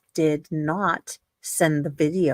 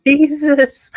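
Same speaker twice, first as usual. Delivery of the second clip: exclaimed Jesus, much louder; loud shocked outburst; 8 semitones higher, 10 dB louder than usual. Real, not acted. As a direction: blurt it out in disbelief. Shocked exclamation at absurd case.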